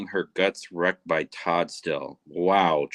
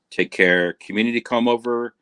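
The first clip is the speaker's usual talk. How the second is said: ordinary talk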